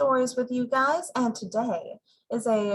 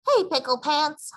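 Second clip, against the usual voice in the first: calling out, higher pitch; hollering; 6 semitones higher, 4 dB louder than usual. A narrator's reading. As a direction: holler across the yard. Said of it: "hollered Mama".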